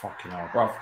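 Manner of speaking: usual speaking voice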